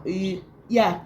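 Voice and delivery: ordinary talk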